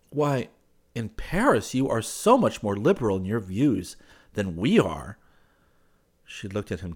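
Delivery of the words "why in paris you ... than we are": higher, emphatic male character; marveling young man. Acted. He marvels to her at Parisian views, emphatically. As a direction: remark with wide pitch swings.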